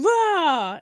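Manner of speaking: imitated jump scare shout. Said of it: "You were like".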